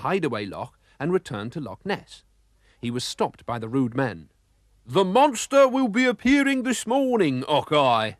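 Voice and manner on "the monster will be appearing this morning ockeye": much higher, louder fake Scottish accent; hammed-up fake Scottish accent; false Scottish accent. A narrator's reading. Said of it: "said MacBurp with his false accent".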